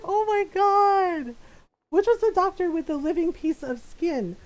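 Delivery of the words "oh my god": high, loud, drawn-out reaction; amused disbelief. Retold or imitated. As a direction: re-enact being floored, stretching it out. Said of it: "And I was just like".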